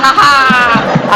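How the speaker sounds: slow, hollow forced laugh; mock cheerful ha ha; fake laugh. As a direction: put on a fake happy laugh. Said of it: "बाहर कर रहे हैं".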